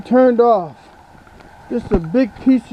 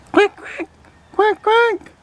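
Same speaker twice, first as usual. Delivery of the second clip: higher toy duck quacking; duck puppet voice; 10 semitones higher, slower than usual. Acted. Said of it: "Can you say quack".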